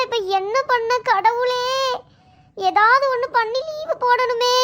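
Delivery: usual speaking voice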